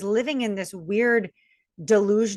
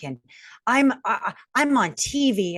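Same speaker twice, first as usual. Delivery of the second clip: normal talking voice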